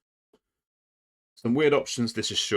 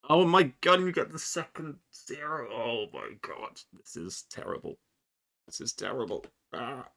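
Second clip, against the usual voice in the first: dismay; 5 semitones higher than usual. Real, not acted. Dice ruin the Fellowship move.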